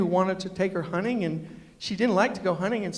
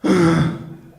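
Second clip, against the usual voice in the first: loud mock disgusted sigh; dog's sigh; 3 semitones lower, 9 dB louder than usual. The dog sighing at him, loud mock sigh. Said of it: "just look and go".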